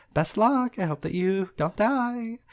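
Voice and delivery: a tiny fairy wishing luck sweetly; Tooth Fairy. Acted. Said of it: "Tooth Fairy ... says".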